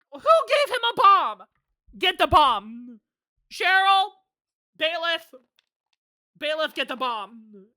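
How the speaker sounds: high, loud outraged demands; mock-panicked yelling. Player orders bailiff to get the bomb, mock-panicked yelling. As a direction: call out for help at full voice. Yells orders at the game court.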